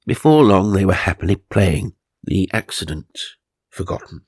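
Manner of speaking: usual speaking voice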